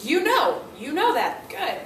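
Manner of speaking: high, loud "oh yeah"; delighted praise. Retold or imitated. Teacher praising a student who got it right, high and delighted. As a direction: praise it warmly. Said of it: "I'll go".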